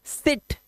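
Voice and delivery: सिट with a quick short i; short vowel. Shown as a demonstration. Teacher showing the short vowel, सिट said quickly. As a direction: keep the i in सिट brief. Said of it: "आई से बना हमारा short vowel sound".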